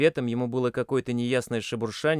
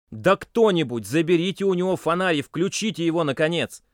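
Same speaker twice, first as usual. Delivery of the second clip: higher, louder commanding yell; demanding shout; 6 semitones higher, 6 dB louder than usual. A narrator's reading. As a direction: bark the order to get them moving. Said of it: "Громче прежнего требовательно закричал".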